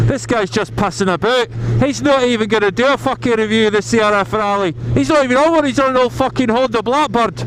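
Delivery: high, far Scottish heckler voice; Scottish accent. Pretend. Mocking viewer in a Scottish accent. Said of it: "my attempt at a brief Scottish accent".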